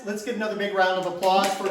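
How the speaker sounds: ordinary delivery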